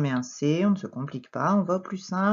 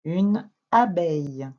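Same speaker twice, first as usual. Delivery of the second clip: "Une abeille" slow and louder, word by word; slow dictation; 5 dB louder, slower than usual. Shown as a demonstration. Teacher dictates the word slowly.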